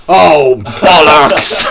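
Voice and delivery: loud slow laughing "Oh, bollocks!"; slow, loud laughing swear; laughing curse. Real, not acted. Falls off edge, laughing.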